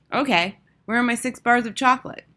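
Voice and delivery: put-on pushy little-boy voice; demanding boy. A narrator's reading. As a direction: demand your treat like a greedy child. Greedy boy demands his chocolate.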